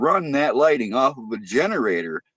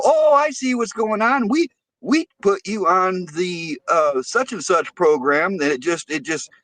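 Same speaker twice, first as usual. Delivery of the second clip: higher, breezy customer-service voice; lightly mock the phone rep; mock utility rep; 6 semitones higher than usual. Caller mimicking the utility rep, breezy higher voice. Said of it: "I go".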